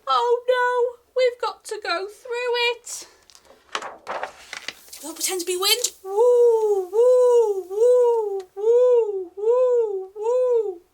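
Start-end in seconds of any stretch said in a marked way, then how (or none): none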